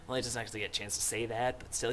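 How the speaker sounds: ordinary delivery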